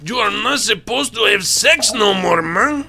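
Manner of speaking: put-on high, loud Chicano-accented wailing rant; Cheech Marin impression. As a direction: play Cheech Marin in a hammed-up Chicano accent, pleading with the cheating wife. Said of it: "my Cheech Marin impression".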